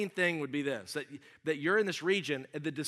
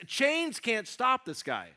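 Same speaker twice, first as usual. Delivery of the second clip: normal talking voice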